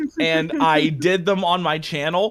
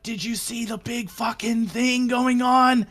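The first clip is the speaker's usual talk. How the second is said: excited townsperson; 6 semitones higher than usual. Pretend. Voicing townsfolk chatter.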